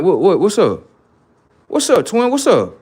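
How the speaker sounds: high, loud, rushed what's up; hyped-up eager greeting. Retold or imitated. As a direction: jump at the news, all ears. Eager reaction to brother's news.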